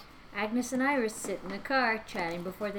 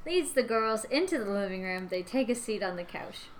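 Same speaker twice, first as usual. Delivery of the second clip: ordinary delivery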